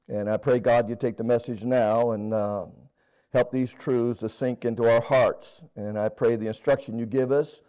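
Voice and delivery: low, earnest spoken prayer. Acted. Preacher leads church in prayer, earnest prayer voice.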